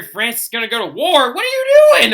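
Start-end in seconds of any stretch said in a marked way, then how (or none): 1.3-2.1 incredulous. Pretend. Mock outrage at going to war.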